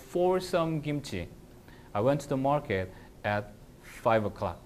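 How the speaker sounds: very slow with "For some kimchi" put up front; fronted phrase. Shown as a demonstration. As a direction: lean on "For" to show emphasis by moving it first. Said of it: "내가 이제 말을 바꿔볼게요".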